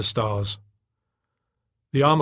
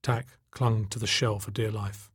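ordinary delivery

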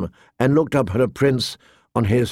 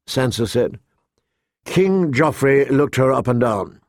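ordinary delivery

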